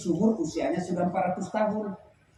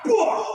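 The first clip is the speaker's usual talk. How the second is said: spitting out; 12 semitones higher, 4 dB louder than usual. Acted. Aisyah spits out salty tea.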